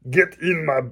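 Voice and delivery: put-on loud, high Schwarzenegger-style Austrian accent; Arnold Schwarzenegger impression. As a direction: bark it like Arnold in an action film. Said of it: "Arnold Schwarzenegger, Scottish accent".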